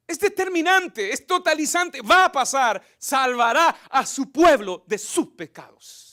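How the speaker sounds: normal talking voice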